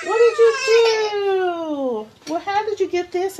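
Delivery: startled mom asking the twin what she did; high motherese scolding. Real, not acted.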